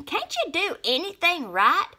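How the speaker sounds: high, loud exasperated snap; snapping. A narrator's reading. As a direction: scold angrily. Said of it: "snapped Snap Bean".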